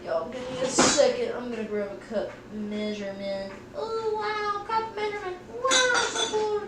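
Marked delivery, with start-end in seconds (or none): 3.8-6.6 high, put-on gushing over a measuring cup; mock amazed voice. Streamer gushing to chat over a cup, high and put-on. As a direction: squeal in fake wonder at the cup. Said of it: "I don't know what I'm saying".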